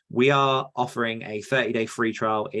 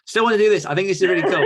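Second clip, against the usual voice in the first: much higher and louder, laughing through it; excited insisting; 9 semitones higher, 8 dB louder, faster than usual. Retold or imitated. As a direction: gush about the idea like a kid. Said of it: "for me to be like".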